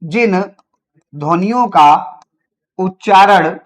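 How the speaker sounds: spaced-out words, long pauses between; slow dictation. Shown as a demonstration. Teacher dictating the consonant definition, slowly. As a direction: dictate word by word. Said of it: "तो लिखिएगा".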